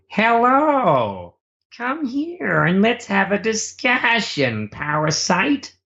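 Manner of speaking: high, slow, creepy sing-out greeting; Man Without a Moustache. Retold or imitated. Unsettling game character beckoning the player, creepy high voice. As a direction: stretch it out high and eerie, luring them in. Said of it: "doing a character called the Man Without a Moustache".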